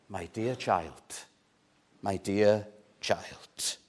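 put-on slow and very soft, my dear child drawn out; tender, loving father. The father pleading with his angry older son, tender and slow. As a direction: coax it gently, like soothing a hurt child.

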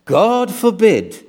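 lightly imitated preacher, high and slow; Martyn Lloyd-Jones. Preacher quoting a famous doctor to his church, lightly mimicked solemn warning. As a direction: draw it out as a solemn pulpit warning, no full accent. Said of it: "I'm not gonna do the accent".

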